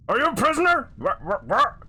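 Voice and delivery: loud, high squawk of disbelief; startled orc. Acted. Orc realises the stranger is a prisoner, startled. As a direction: blurt it out in sudden alarm.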